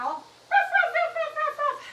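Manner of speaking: very high yappy puppy ruffs; quick string of high-pitched pretend barks; puppy barking. Teacher has children wiggle puppy tails, barking. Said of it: "make a doggy sound".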